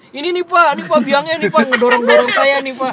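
high, loud blaming outburst; friend snapping loudly. Retold or imitated. Friend loudly blames him in front of the principal, high loud outburst. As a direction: pin it on him at full volume. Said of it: "Temen gua langsung ngegas".

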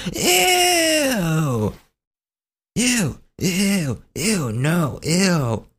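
high, disgusted squealing ew; mock the girls' grossed-out squeal; girls going ew. Middle school girls rejecting a date, high disgusted ew. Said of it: "And all of them were like".

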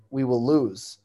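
usual speaking voice

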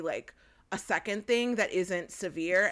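usual speaking voice

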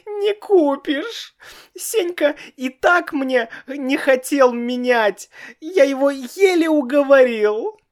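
very high, wailing protest; bawling child. A narrator's reading. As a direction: howl in tears. Child bawls on.

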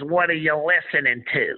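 slow Southern country twang, mocking. Coach needling the player about rap, slow country twang. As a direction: drawl it like a good old country coach. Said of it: "he's got that country twang".

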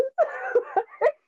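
a high, breathy laugh close to a squeal; squealing giggle. Real, not acted. Laughing at her own question.

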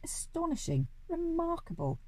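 astonished exclamations, pitched high; amazed cry. A narrator's reading. Duke marvelling at the window cleaners, amazed cries. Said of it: "cried the Duke".

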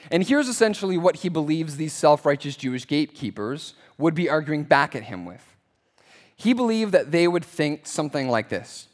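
ordinary delivery

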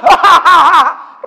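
loud, high gloating cackle; hammed-up spiteful laughter; mocking laugh. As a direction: laugh at someone's pain. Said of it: "malah ketawa".

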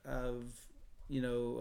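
ordinary talk, nothing marked

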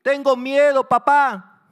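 put-on high, very loud panicked child; frightened wail with stretched words; desperate scream. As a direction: scream in terror for your father. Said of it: "con desesperación".

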